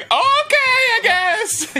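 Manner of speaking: high, slow, teary resigned voice; resigned whimper. Retold or imitated. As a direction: whine it out pitifully. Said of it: "It's like".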